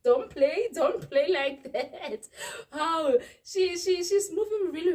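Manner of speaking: put-on loud, heavy South African accent, slow; angry South African warning, slow and loud. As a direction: warn her off in thick South African. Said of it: "My South African accent popped up even worse".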